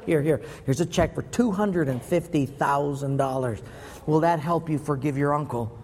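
ordinary delivery

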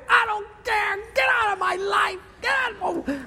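high-pitched mock tantrum; hammed-up fury. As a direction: play the outraged owner, shrieking high. Owner orders driver away.

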